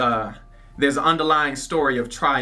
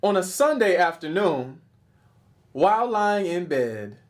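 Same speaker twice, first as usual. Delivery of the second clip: animated slam-poetry delivery; dramatic, rhythmic poetry recital; spoken-word poem; 9 semitones higher than usual. Acted. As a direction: perform it like a poet on stage, punching key words. Performs a poem.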